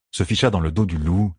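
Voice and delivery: ordinary delivery, nothing marked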